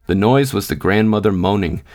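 ordinary delivery

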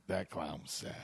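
low, quiet mock-mournful line; a hint of solemn pity; mock sad mourners. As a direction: mourn the clown in a hush. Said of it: "they really were like".

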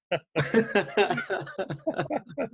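high, drawn-out chuckle; laughing. Real, not acted. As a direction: laugh along at your own joke. Joking about sound quality.

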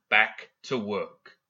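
each word of "back to work" drawn out, with space between; half-speed, deliberate command; slow emphatic order. Acted. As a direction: repeat the order slowly and firmly, "back... to... work".

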